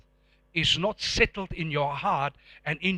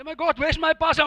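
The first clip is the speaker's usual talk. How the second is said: mock alarm; 6 semitones higher than usual. Acts out losing a tracked parcel.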